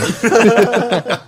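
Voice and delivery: high-pitched laugh; real laugh. Real, not acted. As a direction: laugh at your own absurd joke. Laughs at own dog joke.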